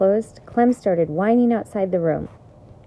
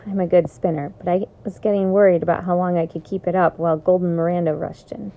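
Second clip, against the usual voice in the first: usual speaking voice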